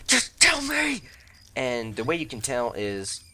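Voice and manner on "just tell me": very high, louder squeaky demand; mock impatient viewer. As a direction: beg like an impatient fan. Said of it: "you're like".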